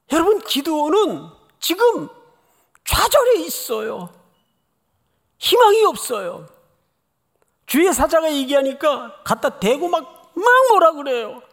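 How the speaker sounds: full-force preacher's voice; impassioned preaching. Acted.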